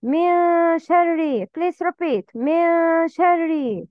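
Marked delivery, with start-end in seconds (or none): 0.0-1.5 من شر with the "n" held as a nasal hum; slow, higher من شر, nasal sound for two beats; ghunnah. Shown as a demonstration. Teacher modelling for learners, nasal hold in من شر. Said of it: "Make غنة with two beats". 2.3-3.9 slow من شر, "n" hummed through the nose; ghunnah. Shown as a demonstration. Teacher modelling for learners, nasal hold in من شر. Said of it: "Nasal sound".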